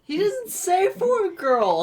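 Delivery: high, loud mimicking of the line; high mock protest. Co-host mimics the heroine's line, high mock protest.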